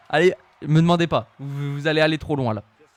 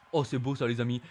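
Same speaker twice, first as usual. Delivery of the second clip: ordinary talk, nothing marked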